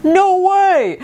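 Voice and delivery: high, drawn-out disbelief; amazed kid. Retold or imitated. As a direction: exclaim it like an astonished child.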